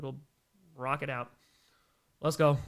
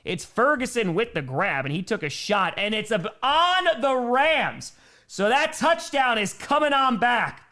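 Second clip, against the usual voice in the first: drawn-out, raised football announcement; live play-by-play; 7 semitones higher, 5 dB louder, slower than usual. Real, not acted. Announcer tells viewers the touchdown is called back, dramatic play-by-play. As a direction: punch out the call, slowing for the verdict.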